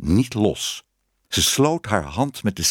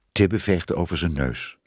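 usual speaking voice

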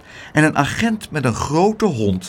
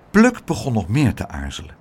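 usual speaking voice

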